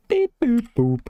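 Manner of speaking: high playful beep boop noises; robot beeps. Pretend. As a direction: chirp it like a little robot. Imitates computer noise.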